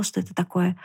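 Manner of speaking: ordinary delivery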